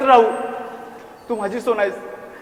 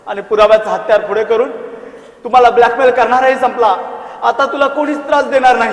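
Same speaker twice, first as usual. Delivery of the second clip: loud gloating promise; 2 semitones higher, 7 dB louder than usual. Acted. Obsessed man reassures Sona.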